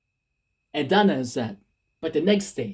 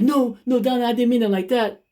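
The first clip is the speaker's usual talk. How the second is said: higher, anxious protest; pleading; 8 semitones higher than usual. Retold or imitated. Boyfriend begging Donna not to misread him, pleading high. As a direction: call her back before she leaves.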